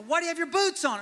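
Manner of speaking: high-pitched exasperated dad. Acted. Frustrated dad to his stuck son, exasperated. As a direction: fire it back high, at your wits' end.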